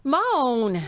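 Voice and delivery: lightly voiced Fonz, stretched out; a hint of a cool greaser drawl. Real, not acted. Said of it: "You did the Fonz".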